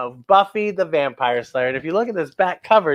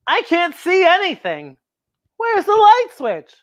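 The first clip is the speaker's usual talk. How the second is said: put-on worried child; 10 semitones higher than usual. Kids stumbling in the dark.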